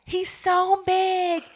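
high, loud, drawn-out whine; playful squealed excuse; mock protest. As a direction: stretch it out in exaggerated complaint. Playful protest about sibling.

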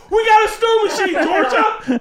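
put-on gleeful kid. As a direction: crow over the win.

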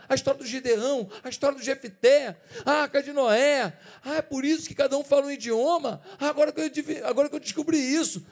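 growing excitement. Retold or imitated.

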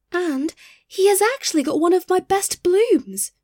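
high, indignant exclamation; outraged rose tree, pitched well up; exclaimed. A narrator's reading. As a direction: exclaim it as a personal affront.

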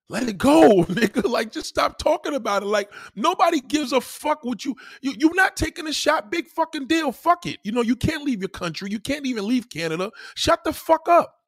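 high-pitched, worked-up dismissal; exasperated retold rant. Telling the moms to let it go, exasperated and high.

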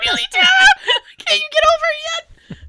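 high, loud mock-exasperated husband. Husband exasperated with his wife, mock whining. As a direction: whine it as the fed-up husband.

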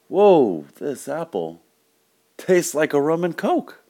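high-pitched, drawn-out amazement; astonished. Acted. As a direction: play a customer wowed by the taste. Customer amazed by apple's taste.

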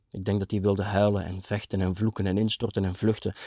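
ordinary delivery

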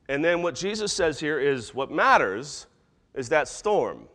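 ordinary talk

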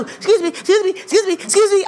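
high, hurried mock excuse-me; pushy passenger. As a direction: imitate someone shoving through a crowd. Mimics passenger pushing through the plane.

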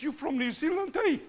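high-pitched retold question with a tagged-on eh; mock Kiwi greeting. Maori bro greets a fellow New Zealander in the mall, high mock greeting. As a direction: act out a bro's high, curious greeting. Acts out a friendly Kiwi's greeting.

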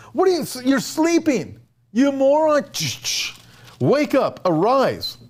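high, exasperated rebuke; scolding captain. Acted. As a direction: snap him awake like a fed-up captain. Captain scolds Jonah, "you moron.

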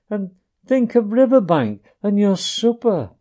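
tearful pleading. A narrator's reading.

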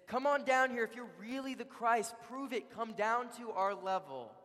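high-pitched jeering dare; taunting challenge. Retold or imitated. Crowd challenges Jesus on the cross, taunting. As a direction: goad him like a heckling crowd.

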